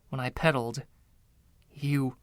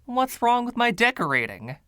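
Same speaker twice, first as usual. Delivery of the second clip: pitched up high in wounded protest; offended; 10 semitones higher, 3 dB louder than usual. A narrator's reading. Narrator protesting the ghost's jab at his decor, offended. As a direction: bristle at the slight to your taste. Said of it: "surprised to feel offended by a ghost".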